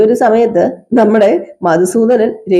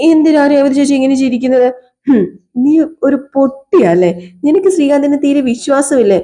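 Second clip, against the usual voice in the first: higher voiced woman; 5 semitones higher than usual. Women arguing about trust.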